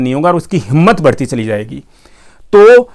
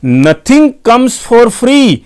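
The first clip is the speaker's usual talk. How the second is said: nothing comes for free stretched very slow, higher and louder; emphatic slogan; 8 semitones higher, 4 dB louder, much slower than usual. Acted. Vlogger warns viewers about free apps, slow and emphatic. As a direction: spell out each word like a motto.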